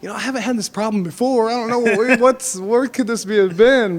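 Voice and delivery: high, put-on embarrassed voice with a laugh; high, mock-innocent apology to the trainer; sheepish excuse. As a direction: plead innocence in a squeaky voice. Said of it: "I was like".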